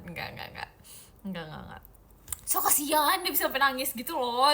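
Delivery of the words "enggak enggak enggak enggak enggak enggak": whispered no. Real, not acted. Shaking off the mean idea.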